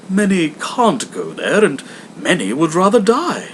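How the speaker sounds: a courteous charity collector; hammed-up polite visitor; portly gentleman.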